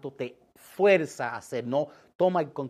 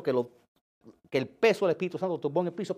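ordinary delivery

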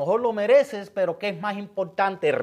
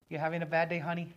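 gentle concern; 7 dB quieter than usual. Retold or imitated. Gentle question to upset cashier.

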